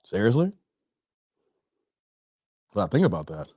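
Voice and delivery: low, deadpan disbelief; incredulous homeowner. Acted. Dave answering the doorstep pitch, low incredulous deadpan.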